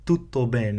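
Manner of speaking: tutto bene with a soft Italian dental T. Shown as a demonstration. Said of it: "Italians in the Italian language would say a T sound".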